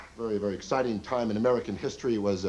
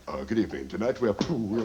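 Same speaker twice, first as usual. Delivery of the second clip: much lower, deadpan giant butler; Lurch waiter; 5 semitones lower than usual. Retold or imitated.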